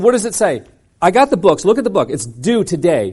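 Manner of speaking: loud, high, exasperated protest; retold protest. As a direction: push back hard at the clerk. Retold argument with librarian.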